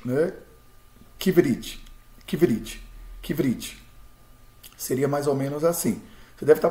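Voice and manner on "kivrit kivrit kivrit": kivrit with a short pause after kiv; pause on veit. Shown as a demonstration. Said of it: "dando uma pausa no veit". (kivrit com pausa no veit)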